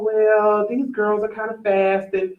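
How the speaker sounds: ordinary talk, nothing marked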